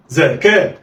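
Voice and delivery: "زكاة" as two open syllables, za-ka, joined; snatch za-ka in "زكاة" with no pause; snatched together. Shown as a demonstration. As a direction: blend "زكاة" like one word. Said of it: "دي طريقة الخطف".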